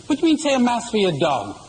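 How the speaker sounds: fast, high-pitched mock outrage; indignant priest. Priest outraged at being asked for a dog's mass, indignant. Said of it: "Father's indignant, he's outraged".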